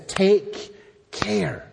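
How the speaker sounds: slow, weighty caution with a falling end; solemn warning. Acted. God warning his people in verse eleven, solemn. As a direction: give each word room as a warning. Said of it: "So it's a warning that's here".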